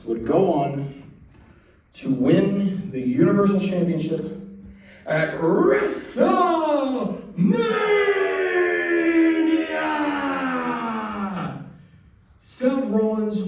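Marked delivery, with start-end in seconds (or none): 5.0-13.4 high, stretched announcer shout; crowd-pumping yell; hype shout. Acted. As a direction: draw out WrestleMania like a big-match call. Hyping Rollins' big win.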